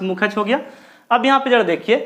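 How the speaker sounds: ordinary talk, nothing marked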